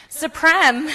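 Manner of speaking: high, bright playful "Supreme"; playful exclamation. Acted. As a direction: toss out "Supreme" with a grin. Playful repeat of French term.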